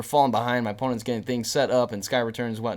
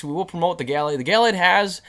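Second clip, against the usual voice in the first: ordinary talk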